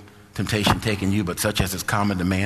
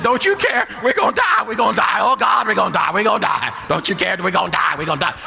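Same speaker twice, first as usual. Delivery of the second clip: high-pitched, frantic cries of doom; mock panic; 9 semitones higher, 3 dB louder than usual. Preacher acting out disciples in the storm, panicked. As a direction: wail it like terrified men in a storm.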